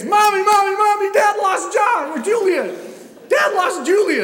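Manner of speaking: put-on high, shouted little-boy alarm; child tattling. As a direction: holler upstairs for mommy. Son runs upstairs yelling to mother.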